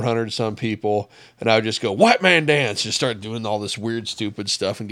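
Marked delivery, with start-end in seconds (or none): 1.9-2.8 higher, louder mock drill call; called-out command. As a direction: call it out to a whole formation for laughs. Calling out to troops in formation.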